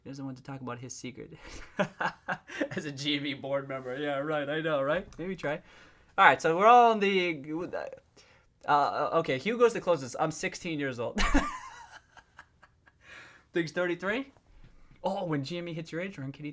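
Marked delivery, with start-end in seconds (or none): 1.4-2.7 short real chuckle; amused laughing burst. Real, not acted. Laughs at the chat's joke. 11.2-12.0 high-pitched burst of laughter; real high giggle. Real, not acted. Laughs at guessed age of sixteen.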